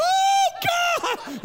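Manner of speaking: very high, loud wail; hammed-up panicked scream to heaven; mock terrified shout. Mimics terrified listener's reaction.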